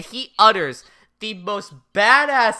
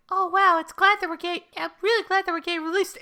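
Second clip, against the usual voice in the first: much higher, fast, airheaded mock cheer; bubbly, clueless relief; naive girly voice; 12 semitones higher, faster than usual. Misa's naive relief in the car.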